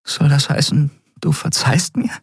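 high, tentative character voice; Endriel, hopeful. Acted. Endriel asks Kai whether he forgives her, hopeful.

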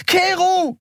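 very high outraged yell; piercing protesting cry; scream. A narrator's reading. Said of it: "schrie sie".

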